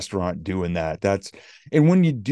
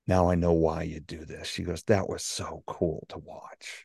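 low, soft retold amazement; hushed awe; 4 semitones lower, 6 dB quieter, slower than usual. Reporter to speaker after watching kind acts, hushed and low. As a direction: lean in and say it quietly, slowing at the end. Retells the reporter's moved reaction.

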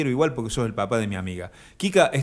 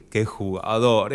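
ordinary talk

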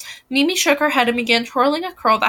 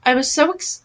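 usual speaking voice

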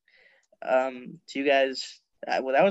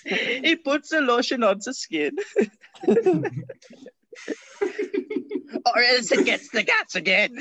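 much higher, laughing mock German creep; German Buffalo Bill; 12 semitones higher than usual. Riffing a German Buffalo Bill for the cohosts, higher and laughing. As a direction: squeal it out through laughter like a cartoon creep. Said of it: "German Buffalo Bill".

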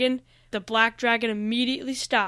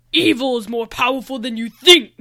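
gloating villain; 8 dB louder than usual. A narrator's reading. Gloating villain answers Noah.

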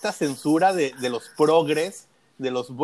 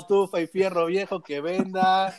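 fierro viejo vendor call; 4 semitones higher than usual. Retold or imitated. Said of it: "por las calles de la Ciudad de México gritando".

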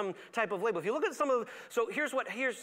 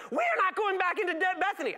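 much higher, whining disciples refusing; whiny protest; 11 semitones higher, 4 dB louder than usual. Retold or imitated. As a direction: whine in protest like scared followers. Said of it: "they had that tone".

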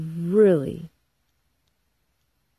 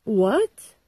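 indignant what; 4 semitones higher, 4 dB louder than usual. Real, not acted. Indignant reaction.

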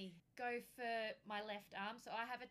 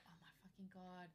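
much softer, lower muttered oath; horrified under breath; 3 semitones lower, 10 dB quieter than usual. Retold or imitated. Patient aghast at the nurse, muttered. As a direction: say it under your breath in horror. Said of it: "I'm like".

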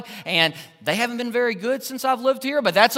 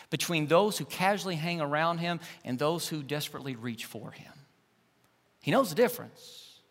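intimate preaching; 4 semitones lower, 6 dB quieter than usual. Acted. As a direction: draw the listeners in quietly.

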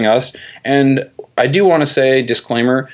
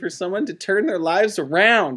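mock sales pitch; 8 semitones higher, 6 dB quieter than usual. Mocking MLM recruiting posts.

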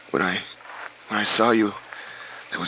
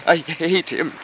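angry; 7 semitones higher, 5 dB louder than usual. Acted. Outburst against father.

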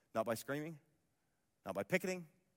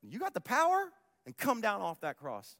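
taunting jeer; 7 semitones higher, 4 dB louder than usual. Retold or imitated. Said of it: "They said".

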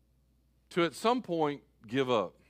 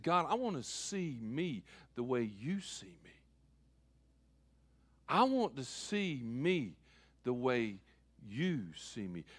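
quiet prayer; 6 semitones lower, 9 dB quieter than usual. Retold or imitated. Pastor retelling his own prayer to God, quiet and sincere. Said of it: "I said".

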